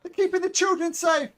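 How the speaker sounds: high, loud, frantic mother's cry; pleading mother. Acted. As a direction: shriek it out as a desperate mother. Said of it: "The mother attempts to tackle you and say".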